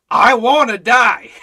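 loud, slow mock cry of longing; Paul's longing. Preacher voicing Paul's wish to depart, loud. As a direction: wail it like Paul, half-joking. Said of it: "he says".

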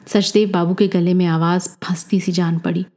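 usual speaking voice

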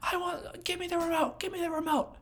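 high pitched whiny demand, repeated; childish whining grab for the remote; tantrum child. Retold or imitated. Trainer playing upset kid demanding the remote, high whine. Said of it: "freaking out".

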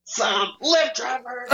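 high, drawn-out belting; mock singer's opener. Riffing on the Lyft driver story, high mock singing. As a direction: stretch it out like a rock hook.

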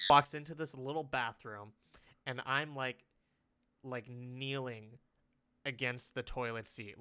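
ordinary delivery

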